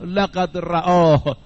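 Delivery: slow, drawn-out solemn oath repeated three times. Retold or imitated. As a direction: swear it slowly and heavily, word by word. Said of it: "سه مرتبه امام صادق قسم خورد".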